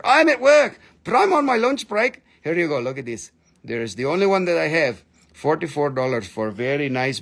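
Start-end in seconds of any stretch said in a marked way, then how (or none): 0.0-2.2 imitating Karen Doyle. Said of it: "she's like".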